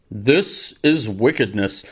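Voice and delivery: deep, slow, sonorous newsreel narrator voice; old-timey documentary announcer. Retold or imitated. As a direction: intone it like a 1950s newsreel. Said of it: "documentary announcers with their sonorous voice".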